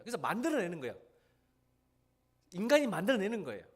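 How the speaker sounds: high, loud fervent sermon voice; fervent preaching. Acted. Preacher rousing the congregation about gods, fervent. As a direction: preach it out with rising force.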